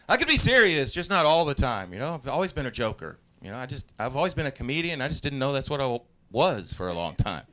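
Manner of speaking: normal talking voice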